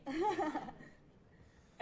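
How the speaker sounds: short embarrassed laugh; nervous laugh. Real, not acted. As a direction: laugh off the nerves. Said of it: "I'm a little nervous".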